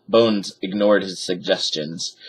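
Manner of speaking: usual speaking voice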